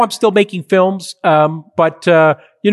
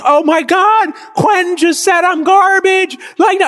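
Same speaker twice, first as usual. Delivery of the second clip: mock panicked wail; 13 semitones higher, slower than usual. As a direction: yelp it out like a crushed young filmmaker. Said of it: "I'm like".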